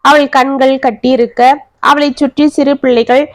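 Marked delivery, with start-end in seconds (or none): none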